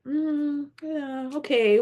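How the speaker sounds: high, noncommittal hum into okay; doubtful "mm, okay", acted out; retold hesitant acceptance. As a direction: agree warily, pitched well up. Acting out her unexcited, wary reaction.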